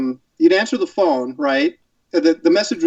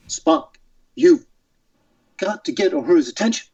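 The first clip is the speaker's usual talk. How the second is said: halting starship captain, pausing between phrases; Captain Kirk; 3 semitones higher than usual. Shown as a demonstration. Guest trying his captain impression on request, halting delivery. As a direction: break the order into dramatic, isolated pieces. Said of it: "Can you do a Kirk?".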